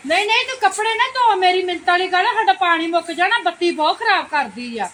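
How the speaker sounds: high, loud hurried refusal; loud protest. Acted. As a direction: shout her down. Neighbor refuses, shouting in skit.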